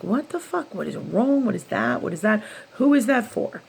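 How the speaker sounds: high, rising, baffled cry of what is going on; exasperated outburst. Retold or imitated. Asking the universe, bewildered and exasperated, high pitched. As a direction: throw the questions up in disbelief.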